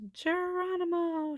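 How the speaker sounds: high, loud, drawn-out battle cry; jump cry. Acted. Jessie jumping from the tree into the bird bath, yelling. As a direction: leap with a whoop. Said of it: "she cried".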